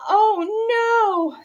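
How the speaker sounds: high, loud alarmed oh no; dismayed cry. A narrator's reading. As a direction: gasp it out in dismay. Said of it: "said her mother".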